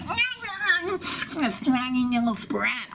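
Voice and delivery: high whining brat noise; snotty-nosed brat. Acted. Showing what he can't do all the time, bratty whine. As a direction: whine it like a taunting child. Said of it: "I can't be like".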